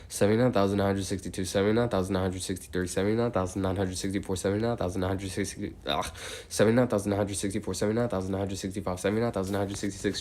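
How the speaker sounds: steady, rhythmic number chant; monotone counting. Real, not acted. Counts aloud toward eighty thousand.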